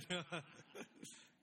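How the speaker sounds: short, breathy laugh; light chuckle. Real, not acted. Pastor joking with congregation after the reading, light chuckle. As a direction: chuckle briefly at your own joke.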